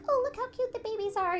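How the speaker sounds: high, sweet gush; gushing ultrasound lady. Retold or imitated. Mom mimicking the sonographer's high gushing. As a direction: coo at the tiny babies on the screen.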